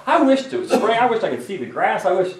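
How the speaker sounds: mock congregation member. Congregation member sarcastically answering the preacher, high whiny voice. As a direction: play a fed-up churchgoer answering back. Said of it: "You say".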